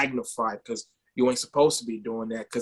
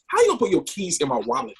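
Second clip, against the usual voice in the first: higher-pitched mock disbelief; retold incredulity; 7 semitones higher, faster than usual. Dad questioning his son's excuse, incredulous. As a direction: challenge the excuse, mildly exasperated. Dad retells arguing with son.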